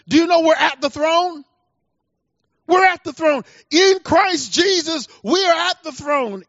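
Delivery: high, loud, fervent preaching; preacher building to a passionate peak; full-force preaching. Acted. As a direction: lift the congregation to the throne.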